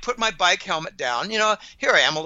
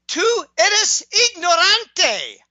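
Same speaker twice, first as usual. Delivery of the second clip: higher, louder and slower, spelling out each word; slow Spanish insult; 11 semitones higher, 5 dB louder, much slower than usual. Retold or imitated. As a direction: lean on every syllable of the insult.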